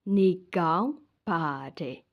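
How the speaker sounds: ordinary delivery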